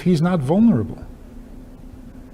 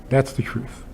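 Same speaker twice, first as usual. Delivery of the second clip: whispered aside; 9 dB quieter than usual. Real, not acted. Joking aside after book title.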